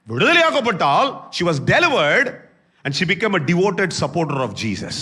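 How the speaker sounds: high, loud preaching with drawn-out English; full-force preaching. Real, not acted. Preacher telling of the delivered woman, full-force preaching. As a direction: proclaim it loudly, stretching the words.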